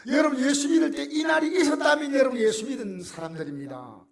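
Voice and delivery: ecstatic shout. Real, not acted. Preacher to congregation about the joy of faith, shouting in rapture. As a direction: soar up in rapture.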